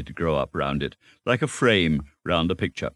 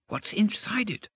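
high, softer, excited question; wriggling curiosity; 4 dB quieter than usual. A narrator's reading. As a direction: ask it squirming to know. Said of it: "wriggling with curiosity".

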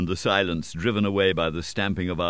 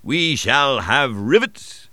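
loud, slow, triumphant shout; slow jubilant shout of good news; 5 semitones higher, 5 dB louder, slower than usual. A narrator's reading. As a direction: call it out across the deck, drawn out.

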